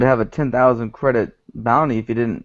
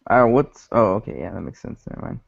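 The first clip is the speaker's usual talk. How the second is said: ordinary talk, nothing marked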